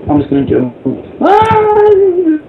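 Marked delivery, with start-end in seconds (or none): 1.2-2.4 sudden high yelp as he jumps; short high cry of surprise; startled yelp. Real, not acted.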